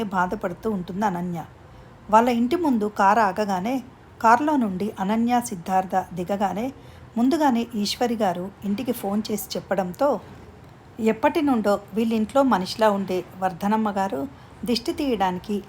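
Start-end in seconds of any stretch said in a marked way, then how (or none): none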